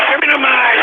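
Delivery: high, exasperated outburst; sudden yell. Real, not acted. Host demanding the mic, sudden yelling. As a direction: explode on the repeated demand.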